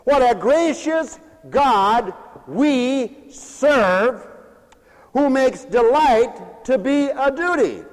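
drawn-out preaching cadence; slow preaching. Acted. Pastor drawing out each phrase to his congregation, slow. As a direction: let each phrase hang for the church.

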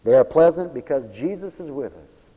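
usual speaking voice

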